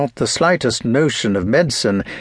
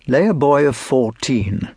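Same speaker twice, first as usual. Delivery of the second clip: ordinary delivery, nothing marked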